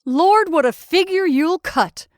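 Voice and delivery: slow, loud, high-pitched taunt; hard, jeering. A narrator's reading. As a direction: jeer at them, dragging it out. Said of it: "He laughed in a hard voice".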